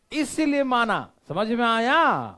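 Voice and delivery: loud, high teacher's shout; emphatic shout. Real, not acted. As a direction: shout the point home to the class. Teacher drives the point home. (ज़ोर से चिल्लाकर समझाते हुए)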